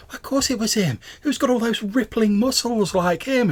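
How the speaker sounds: put-on high-pitched, emphatic young woman; insistent young fan. Young fan defends her sighting to her friend, high and emphatic.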